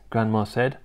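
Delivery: plain reading voice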